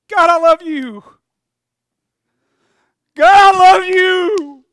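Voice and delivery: high, loud, slow cry of love; loud tearful cry. Acted. Wounded man crying love to Samaritan, loud. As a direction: shout your love to your rescuer.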